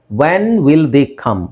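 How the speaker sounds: very slow, each word separated; emphatic model question. Shown as a demonstration. Teacher models the corrected question. (ನಿಧಾನವಾಗಿ, ಪ್ರತಿ ಪದ ಬಿಡಿಸಿ ಹೇಳುವುದು)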